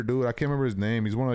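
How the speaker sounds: ordinary talk, nothing marked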